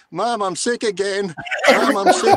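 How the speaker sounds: put-on high, laughing whine of a kid; whiny sick kid. Boy whining to his mom, high, laughing. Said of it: "John's like".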